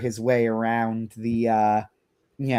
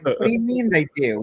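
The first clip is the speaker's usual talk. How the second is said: higher-pitched incredulous protest; retold disbelief, pitched up in protest; mock indignation; 5 semitones higher than usual. Retold lawyer challenges the demon over lost wishes, indignant.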